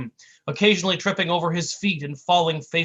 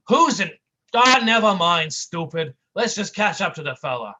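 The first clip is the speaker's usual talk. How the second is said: gruff tough guy; 3 semitones higher, 6 dB louder than usual. A narrator's reading. Brick's voice.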